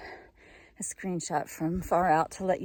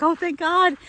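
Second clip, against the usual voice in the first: relieved excitement; 9 semitones higher, 9 dB louder, slower than usual. Retold or imitated. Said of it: "I was like".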